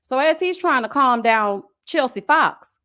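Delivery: normal talking voice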